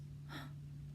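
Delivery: breathy gasp of delight. Acted. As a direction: gasp at the flowers. Girl gasps at flowers.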